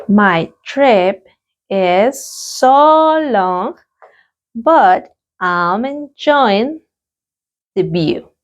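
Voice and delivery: very slow, stretched repetition; a drawn-out classroom repetition; very slow repeat. Shown as a demonstration. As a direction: draw out so long for learners. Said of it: "Let's practice the pronunciation".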